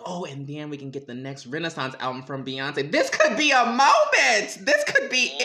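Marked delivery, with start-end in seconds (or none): 2.9-5.4 loud, high, laughing; excited squeal. Real, not acted. Hoping for [name] album.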